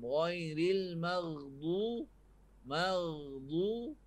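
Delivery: Ghairil maghdu- very slow, the long du held for two beats; two-beat long vowel. Shown as a demonstration. Ustaz stretching the vowel in maghdu as a model, slowly. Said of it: "mat asli dua harakat".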